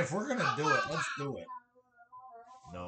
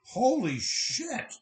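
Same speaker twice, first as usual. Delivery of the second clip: amazed; 8 semitones higher, 3 dB louder, much slower than usual. Real, not acted. Amazed at the map.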